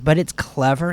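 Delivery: ordinary delivery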